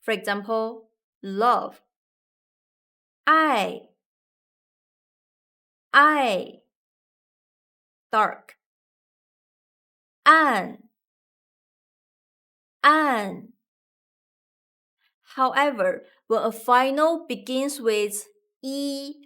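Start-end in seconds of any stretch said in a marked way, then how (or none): 3.3-13.5 I and An said clearly, each falling; finals drill. Shown as a demonstration. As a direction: say each final as its own syllable. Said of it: "finals can form independent syllables".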